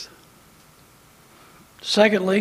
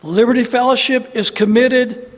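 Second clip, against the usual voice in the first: loud defiant rallying preaching; rally the congregation, fired up; roaring rallying speech; 5 semitones higher, 5 dB louder than usual. Acted.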